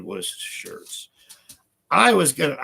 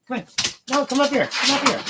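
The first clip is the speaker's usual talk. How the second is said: much higher, louder coaxing call; pet talk to dog; 4 dB louder, faster than usual. Real, not acted. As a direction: coax the dog up onto the couch.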